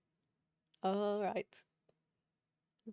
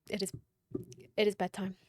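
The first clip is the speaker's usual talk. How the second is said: ordinary delivery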